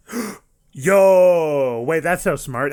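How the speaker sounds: gasp, then a loud excited "yo, wait"; sudden realization. Real, not acted. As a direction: caught off guard by a clever idea, burst out. Realizes a smart idea mid-episode.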